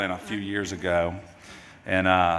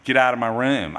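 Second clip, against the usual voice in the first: louder, a mild mock yell; 3 semitones higher, 8 dB louder than usual. Father recalling his son yelling from his room, mild mock yell. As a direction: shout it half-heartedly like a teen. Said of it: "I don't hear Robert screaming".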